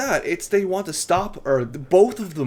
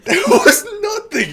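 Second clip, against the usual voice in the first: loud, squealing high through laughter; real hysterical laughing, voice shooting up; helpless laughter; 12 semitones higher, 8 dB louder than usual. Real, not acted. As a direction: crack up in disbelief while shouting it. Laughing hard at absurd death scene.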